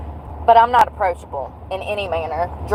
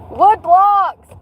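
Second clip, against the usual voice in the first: higher and louder triumphant punchline; punchline emphasis; 10 semitones higher, 5 dB louder than usual. Real, not acted. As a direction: sell the pun proudly. Punchline of her own joke.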